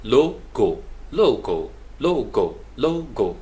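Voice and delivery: लोगो as "loh-goh", both o's long and round; teacher modelling लोगो as two long syllables; LOGO syllable by syllable. Shown as a demonstration. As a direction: sound out लोगो, loh... goh, falling at the end. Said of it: "इसको एल ओ एच और जी ओ एच".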